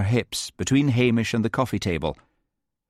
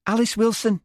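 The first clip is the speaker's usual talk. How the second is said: put-on slight Liverpool accent; 14 semitones higher than usual. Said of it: "Slight Liverpool accent".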